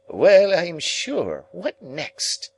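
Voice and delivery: high, slow, scandalised sniff; scornful Sarah Pocket. A narrator's reading. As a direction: sniff at the boy in a shocked lady's voice.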